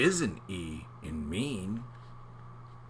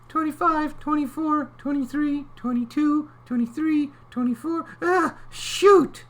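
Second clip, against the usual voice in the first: very high even count; clam's squeaky countdown; 4 dB louder than usual. Acted. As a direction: count carefully in a tiny voice. Clam counts down, fails.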